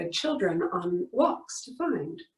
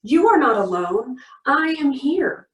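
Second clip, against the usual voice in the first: louder declaration; 3 semitones higher, 4 dB louder than usual. Shown as a demonstration. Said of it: "Say it with me".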